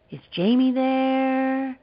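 slow, high French voice stretching the words; a ghostly French man, drawn out; French accent. Retold or imitated. Caller's voice on the phone, slow French accent. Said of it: "the voice had an accent and prolonged the syllable".

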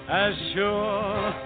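ordinary delivery

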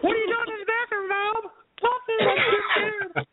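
put-on kid and mom quote. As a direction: play the kid asking, then the mom answering. Kid called from bathroom, mom voice.

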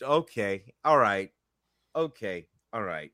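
low, fading to very soft; resigned. Real, not acted. Host giving up arguing with his co-host, resigned.

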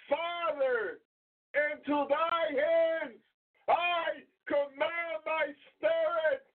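high, slow, shouted prayer; loud cry to the Father. Acted. Jesus crying out to the Father, high and loud. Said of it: "He cried with a loud voice".

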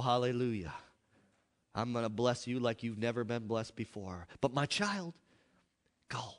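ordinary talk, nothing marked

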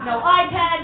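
ordinary delivery

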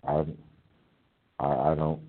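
ordinary delivery

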